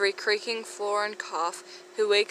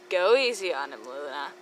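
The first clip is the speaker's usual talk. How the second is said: dry; 6 semitones lower than usual. Acted. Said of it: "Draco dryly".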